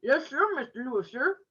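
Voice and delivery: put-on boy talking with his mouth full. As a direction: talk around chewed sandwich. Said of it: "part of the sandwich ... into the side of my mouth".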